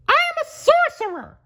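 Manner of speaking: high cartoon mouse voice insisting; Mickey crying out. Mickey insisting to the marching brooms, high mouse voice. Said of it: "cried Mickey".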